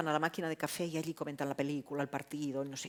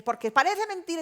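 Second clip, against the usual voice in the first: raised, indignant protest; acted outburst with voice raised; raising the voice; 9 semitones higher, 6 dB louder than usual. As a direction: push it higher and louder, as in a heated meeting. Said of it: "finalmente tú la levantas".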